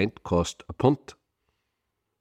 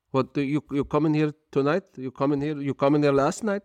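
put-on high, incredulous foreign-accented grilling; a big Turkish owner quizzing the drunk student; Turkish kebab shop owner; 7 semitones higher than usual. Shop owner grills drunk student, high incredulous accented voice. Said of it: "he said to him".

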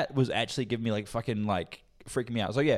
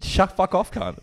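playful loud retort; 8 semitones higher, 6 dB louder, slower than usual. Real, not acted.

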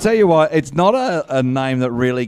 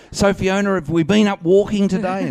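high, chirpy patronising voice; mock health-worker singsong, pitched up; physiotherapist impression; 8 semitones higher than usual. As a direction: talk to a patient like a child. Imitates physiotherapist addressing patient.

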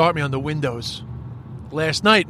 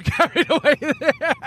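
breaking into high giggles; real laughter taking over the words; laughing; 9 semitones higher, slower than usual. Real, not acted. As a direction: apologise through a laugh. Laughs at own singing.

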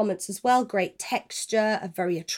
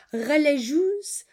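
French accent; 4 semitones higher, slower than usual. Shown as a demonstration. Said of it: "I just really wanted to say that in a French accent".

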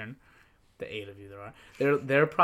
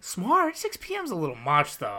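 incredulous; 6 semitones higher, 3 dB louder than usual. Real, not acted. Host disputing that her parents were smart, incredulous. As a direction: pitch leaps up in disbelief, louder.

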